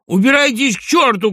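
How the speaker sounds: high, loud anguished yell; tearful shout. A narrator's reading. Doctor cursing the departing guests, tearful shout. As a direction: cry out in desperate rage. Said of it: "крикнул он плачущим голосом".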